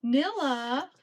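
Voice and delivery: loud high call across the house; calling the dog. Real, not acted.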